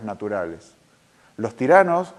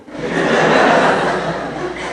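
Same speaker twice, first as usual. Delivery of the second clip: loud breathy laugh; unvoiced laugh burst; real laugh; 4 semitones higher, 6 dB louder than usual. Real, not acted. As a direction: laugh with delight. Laughs at daughter's idea.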